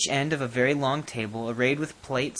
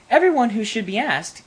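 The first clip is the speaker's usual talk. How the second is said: queen's voice; 8 semitones higher, 5 dB louder than usual. A narrator's reading. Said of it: "answered the queen".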